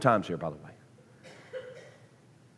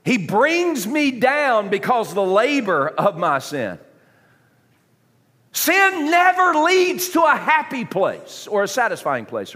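high, ringing sermon declaration; preacher hammering the point; emphatic preaching; 10 semitones higher, 4 dB louder, slower than usual. Real, not acted. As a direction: proclaim it with force. Preaches at full force.

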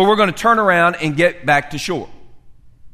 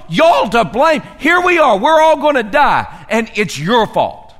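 loud, higher accusing rant; mock blaming rant; 4 semitones higher, 4 dB louder than usual. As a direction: hurl blame at each disciple, hammed up. Acting out the blamer in the boat.

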